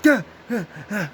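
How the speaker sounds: high, loud panicked huffs; panicked gasping. Retold or imitated. Dreamer panicking at gunfire, high gasping huffs.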